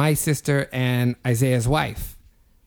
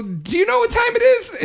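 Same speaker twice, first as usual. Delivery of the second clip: alarmed. Retold or imitated. Retold alarmed question.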